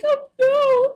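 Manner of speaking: hammed-up, very high loud cry; mock-dramatic, high-pitched lament; emotional wail. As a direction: re-enact a heartbroken wail. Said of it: "I was very emotional".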